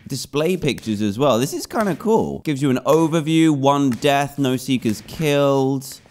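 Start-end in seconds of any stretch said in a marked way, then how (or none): none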